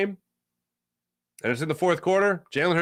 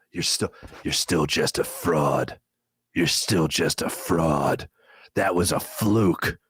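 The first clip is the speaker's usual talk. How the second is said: much lower, slow, menacing jerk of a voice; the inner monologue voice mocking his success, demonic and slow; 12 semitones lower, slower than usual. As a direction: growl it like a demon whispering doubt. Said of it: "it's more demonic is what it is".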